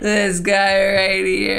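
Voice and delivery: very slow, each word stretched; slow drawn out. Acted. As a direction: drag the words out slowly. Repeats the phrase, second time stretched.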